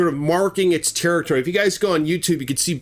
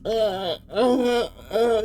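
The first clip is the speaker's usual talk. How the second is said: short, high-pitched moans; weak helpless grunts; 8 semitones higher, much slower than usual.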